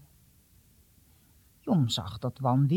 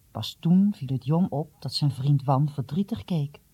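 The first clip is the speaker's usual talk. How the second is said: plain reading voice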